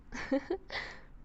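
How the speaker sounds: high, airy, unvoiced chuckle; soft breathy laugh. Captor laughing at her captive's tears, breathy.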